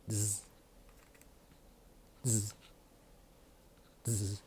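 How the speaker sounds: soft buzzing clipper noise through the teeth. Retold or imitated. To viewers, mimicking the stylist's clippers with a soft buzz. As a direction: hum it like an electric trimmer, quietly.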